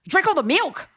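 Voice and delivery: very high mock outrage; mock meltdown. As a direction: squeal it like a staged tantrum. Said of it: "there's just no way that's real".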